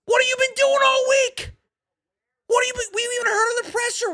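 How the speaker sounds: high, strained angry shouting; furious rant. Real, not acted. Streamer yells at the coach over the team looking worse, furious. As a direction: yell it at the coach in disbelief. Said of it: "I'm gonna start getting emotional on this shit".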